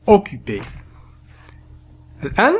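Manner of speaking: ordinary talk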